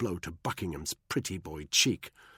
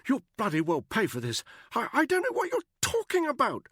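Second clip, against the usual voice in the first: high, loud, nervous stammer; stammering Buckingham; 5 dB louder than usual. A narrator's reading. Buckingham cornered by Danny, stammering high. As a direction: trip over the words in a high upper-class voice.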